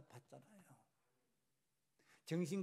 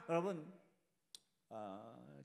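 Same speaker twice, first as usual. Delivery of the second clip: normal talking voice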